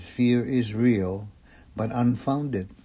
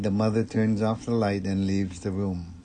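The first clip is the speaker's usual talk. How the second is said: ordinary delivery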